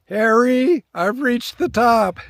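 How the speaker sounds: loud, slow triumphant call. Pretend. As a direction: shout it like you've conquered the summit. Calling out to Harry at summit.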